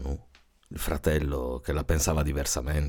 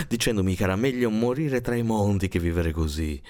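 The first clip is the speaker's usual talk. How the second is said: ordinary delivery, nothing marked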